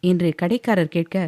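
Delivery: plain reading voice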